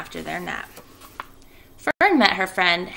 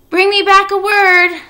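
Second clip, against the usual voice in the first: high, loud call after him; Charlotte calling out; 13 semitones higher, 11 dB louder than usual. Acted. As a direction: call it out across the dark. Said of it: "Charlotte called after him".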